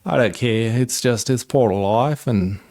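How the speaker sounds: mock Aussie rant at a whining dog; Australian accent. Telling off his crying dog, mock Australian accent rant. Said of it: "All right, that was bad".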